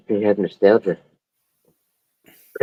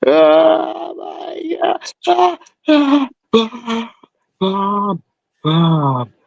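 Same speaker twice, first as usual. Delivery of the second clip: high, slow, crying wail for mom; panicked wail; much slower than usual. Retold or imitated. Stoned son cries out to his mom in panic. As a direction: sob out her name like a terrified child.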